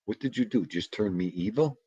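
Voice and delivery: ordinary talk, nothing marked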